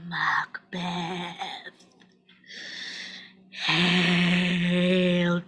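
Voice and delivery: very slow, low, hushed hail; witch's prophecy. Acted. Witch greets Macbeth with prophecy, slowly intoning. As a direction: draw every word out like an incantation.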